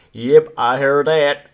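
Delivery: slow Southern American drawl, hammed up; Southern American accent. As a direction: mimic a Southern US pundit, lazy and slow. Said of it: "proper, like, Southern American".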